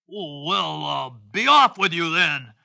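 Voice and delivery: storybook troll voice; grudging troll. Acted. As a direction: grudgingly wave the goat off as a troll. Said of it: "said the troll".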